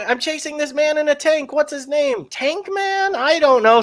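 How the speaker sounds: Andy Dick's police officer squealing in panic; silly cop voice. Retold or imitated. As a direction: play the panicked officer chasing Batman. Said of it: "And he's like".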